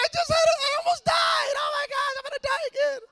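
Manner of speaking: put-on high, loud wailing protest of a scared little boy; panicked kid yelling. Little boy wails at his mom mid-whooping, high and loud. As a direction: shout it like a kid getting a whooping. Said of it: "And I'm like".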